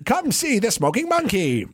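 high, showy barker call; carnival barker. Pretend. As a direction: drum up a crowd at the fair.

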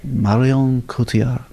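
laboured, slow try at French "Marion Cotillard", final D silent; attempted French pronunciation. Shown as a demonstration. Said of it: "what happened to your face".

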